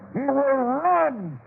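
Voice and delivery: gleeful jeer with a laugh; gloating taunt. Acted. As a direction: taunt them as they go. Mocking freed captives.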